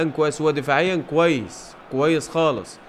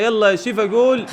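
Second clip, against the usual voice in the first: higher, louder call for the goal; excited goal call; 6 semitones higher, 4 dB louder than usual. Real, not acted. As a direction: urge the striker on to score. Calling a goal in play. (صيحة حماسية بالجول)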